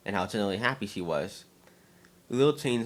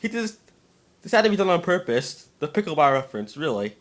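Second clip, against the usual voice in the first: amused disbelief; 8 semitones higher, 6 dB louder than usual. Real, not acted.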